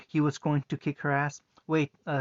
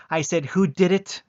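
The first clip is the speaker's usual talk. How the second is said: higher, louder furious repeat; furious shout; 4 semitones higher, 9 dB louder than usual. Acted. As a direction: bellow the question in rage. Sister losing her temper.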